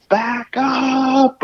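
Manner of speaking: very high screamed command to the wife; desperate scream. Retold or imitated. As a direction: shriek it at someone who cannot hear you. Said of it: "I scream".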